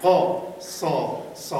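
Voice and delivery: قا صا صا broken into separate open syllables, loud start. Shown as a demonstration. Teacher breaks the word into syllables for students. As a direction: spell out قا صا صا for the class. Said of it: "بخونید".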